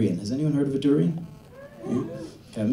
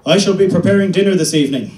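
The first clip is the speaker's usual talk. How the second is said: loud, higher mock-formal announcement; stiff formal husband; 8 semitones higher, 10 dB louder than usual. Husband announcing dinner to his wife in mock formality. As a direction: proclaim it like a stilted butler. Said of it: "what I would do is say".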